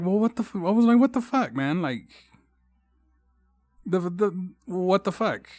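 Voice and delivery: high, stunned reenacted outburst; retelling his shock at his friend's politics; shocked disbelief. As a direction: replay his own stunned reaction. Shocked reaction at friend's house.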